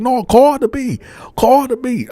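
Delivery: imitating a furious girlfriend; angry girlfriend. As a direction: confront him over the photos. Girlfriend insists.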